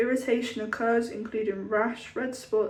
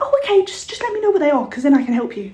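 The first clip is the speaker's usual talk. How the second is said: high, louder insistent helper; mock shop assistant; 4 semitones higher, 8 dB louder, faster than usual. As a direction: keep pushing help on her. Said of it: "And he was like".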